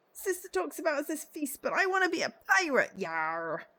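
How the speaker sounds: high, loud mock pirate yell; a kid playing pirate, shouting high; little boy waving a stick at a stranger, shouting pirate-style.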